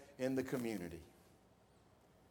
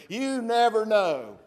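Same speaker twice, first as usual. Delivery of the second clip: "never" kelimesini vurgula, yavaş; slow, stressed never; 5 semitones higher, 9 dB louder, slower than usual. Shown as a demonstration.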